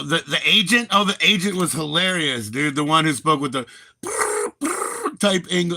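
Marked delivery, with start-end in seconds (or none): 4.0-5.2 high trilled "brrr"; exaggerated rolling R trill; imitated rolled R. Imitating the agent's British accent, high rolled trill. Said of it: "British accent where she would roll".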